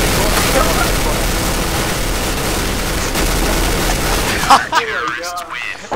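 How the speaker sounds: long high cackling laugh; loud laughter. Real, not acted. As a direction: burst out laughing. After double kill.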